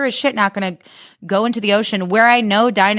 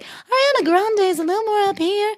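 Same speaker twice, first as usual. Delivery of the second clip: much higher, light celebrity voice; 14 semitones higher than usual. Shown as a demonstration. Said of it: "kind of like an [name] impression".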